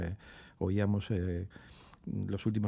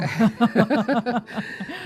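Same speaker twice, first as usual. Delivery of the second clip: bursts out laughing, high and loud; a real laugh at the joke; 13 semitones higher, 7 dB louder than usual. Real, not acted. Guest laughing at the host's joke about Bilbao, real laugh.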